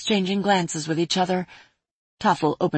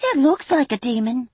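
fairy voice; 7 semitones higher than usual. Acted. Fairy voice in read story.

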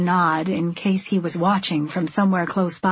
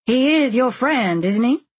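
put-on nosy woman needling a girl; prying busybody; 5 semitones higher, 3 dB louder than usual. A narrator's reading.

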